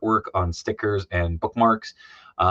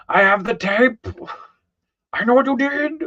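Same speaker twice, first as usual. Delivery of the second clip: spooky high voice; 5 dB louder than usual. Acted. Quote read from stream, acted.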